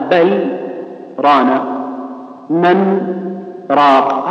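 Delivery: a clean silent break between بل and ران, من and راق; brief sakt pauses. Shown as a demonstration. Teacher modelling the Hafs reading, slight pause before ران and راق. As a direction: halt after بل and من before going on. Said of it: "تسكت سكتة لطيفة".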